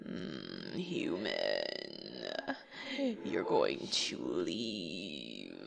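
very low, slow, soft haunting voice; hushed, deep ghostly menace; spooky ghost. Acted. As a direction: intone it deep and drawn out to scare a sleeper. Ghost haunts the sleeping man.

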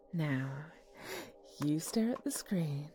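slow, low hypnotist voice; hypnotic voice. Acted. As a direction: lull him into a trance, gently. Hypnotizing partner with phone app.

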